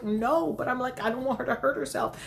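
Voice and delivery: high, hurried, worried protest; frantic. Retold or imitated. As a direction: retell it in a fluster. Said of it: "And I am frantic".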